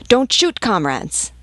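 loud, high plea that drops on the last word; urgent call. A narrator's reading. Vivandière turning to the soldiers to halt them, urgent shout. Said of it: "Then turning to the soldiers".

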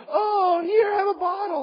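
high-pitched, hammed-up pleading mother; mock a frantic mama soothing her baby; fussing mama. Mama picks up her screaming baby, hammed-up high fussing. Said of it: "Mama picks him up and says".

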